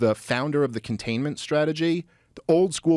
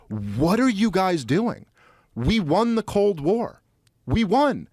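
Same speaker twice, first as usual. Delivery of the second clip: higher-pitched, heated exasperated rant; impassioned imitation of an angry diplomat, pitched up; retold furious rant; 8 semitones higher than usual. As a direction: channel an outraged old Cold Warrior scolding Washington. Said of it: "like, furious".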